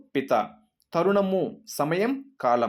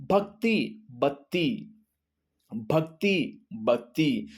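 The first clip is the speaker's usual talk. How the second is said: slow pronunciation drill; much slower than usual. Shown as a demonstration. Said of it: "రెండు మూడు సార్లు pronounce చేస్తే".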